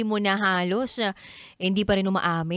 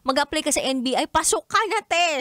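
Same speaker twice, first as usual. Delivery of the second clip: teasing excited; 8 semitones higher than usual. Real, not acted. Teasing her friend about detective skills, excited and high. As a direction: pitch climbing high in playful teasing.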